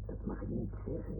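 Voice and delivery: quick, very soft whisper; hushed, hurried telling-off; hushed reproof. Acted. Hushed reproof about a sore subject.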